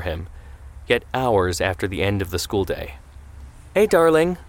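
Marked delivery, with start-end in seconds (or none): 3.7-4.4 raised, higher call to a loved one. A narrator's reading. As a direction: call out from another room. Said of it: "Hadassah called from the kitchen".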